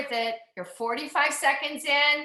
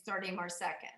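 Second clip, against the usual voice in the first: quiet; 6 semitones lower, 12 dB quieter, faster than usual. Real, not acted. Instructor counts down, repeats fading.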